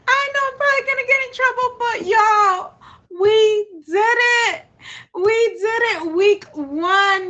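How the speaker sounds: high, loud, ecstatic cheering; overjoyed celebration. Real, not acted. Host tells viewers they did it in week one, overjoyed. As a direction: whoop it to the crowd in disbelief. Celebrating a goal reached on stream.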